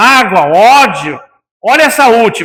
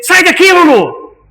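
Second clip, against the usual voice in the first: sharp, high, loud yell; a retold angry yell at the dog; angry shout at dog; 8 semitones higher, 3 dB louder than usual. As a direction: shout the dog away in anger. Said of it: "e gritei com ele".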